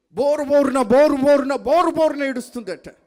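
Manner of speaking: loud, high, dramatised retelling of wailing; mock bawling. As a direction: hammer out the repeats loudly like a wail. (బోరున ఏడుస్తున్నట్టు గట్టిగా)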